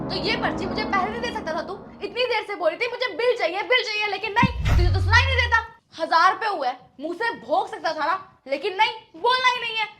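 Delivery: irritated woman berating the delivery man; angry scolding. Acted. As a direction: tell him off hard over the slip. Scolding him over the bill.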